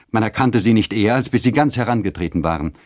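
plain reading voice

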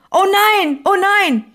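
höher und lauter, panisch nachgeahmt; erschrockener Ausruf. Retold or imitated. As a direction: ruf es wie in plötzlicher Panik. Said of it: "Und der blinde Mann rief". (blinder Mann merkt, er verpasst die Haltestelle, panisch)

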